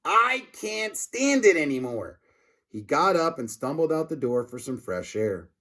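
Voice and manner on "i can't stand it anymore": high, slow, fed-up grown-up voice; a principal who's had enough of gross food. Acted. Principal giving up on lunch, slow and high.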